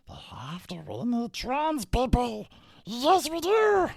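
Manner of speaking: high, loud, slow mocking screech; shrill mock-hysterical chant; mocking shriek impression. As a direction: parody her as a hysterical screamer. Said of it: "That's what she should have sounded like".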